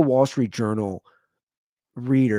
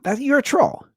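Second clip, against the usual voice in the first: high-pitched playful accusing; mock accusation. Dad to two-year-old hugging him, mock accusing. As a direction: call out the toddler in a high voice.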